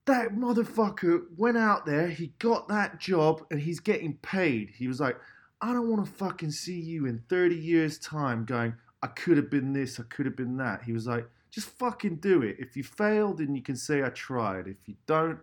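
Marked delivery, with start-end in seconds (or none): 0.1-4.7 high, loud angry outburst; angry friend. Retold or imitated. Friend telling Ed to stop moaning, angry raised voice. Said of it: "he got really angry at me". 5.6-10.8 angry friend. Retold or imitated. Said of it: "he got really angry at me". 11.5-15.4 angry friend. Retold or imitated. Friend telling Ed to stop moaning, angry raised voice. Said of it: "he got really angry at me".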